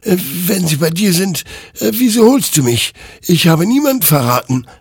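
defensive protest. Acted. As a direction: push back in panic. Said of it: "wehrte sich Hektor". (abwehrend, viel höher und lauter)

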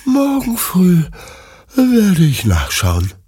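drowsy dog's thought. A narrator's reading. Bruno plans for morning before falling asleep, drowsily drawn out. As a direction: stretch it out high, then let it sink.

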